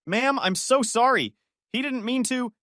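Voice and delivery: calm the neighbor down; pleading apology. Acted. Haley apologizes.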